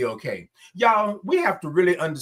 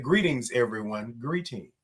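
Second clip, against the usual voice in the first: lower, settling softer on "Greetings"; odd low greeting; 6 semitones lower, 6 dB quieter than usual. Real, not acted. Host reads Mississippi's greeting aloud, oddly low. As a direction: drop your pitch for a formal-sounding hello. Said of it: "why did I say it like that".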